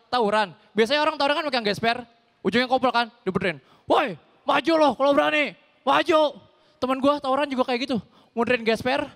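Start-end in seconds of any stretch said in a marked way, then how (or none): 3.8-6.4 half-yelled dare; mild mock shout; mock brawl challenge. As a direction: call him out, lightly hammed. Friend's brawl challenge acted out.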